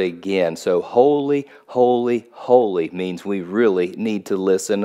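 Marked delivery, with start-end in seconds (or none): 0.8-2.9 HOLY, HOLY, HOLY with deliberate weight on each word; three separately stressed repeats of "holy"; emphatic repetition. Shown as a demonstration. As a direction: lean hard on every "holy", one at a time. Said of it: "a thousand pounds of emphasis on that word".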